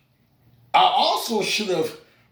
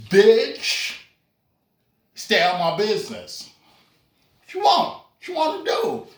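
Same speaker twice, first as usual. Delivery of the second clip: high, cocky fight taunt; mock-tough challenge; 3 semitones higher than usual. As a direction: puff up and dare her. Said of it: "I said".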